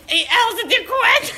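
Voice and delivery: sickly dubbing parody breaking into laughter; mock tuberculosis voice. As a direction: imitate a sickly smoker, loudly hammed up. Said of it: "como si tuviera tuberculosis".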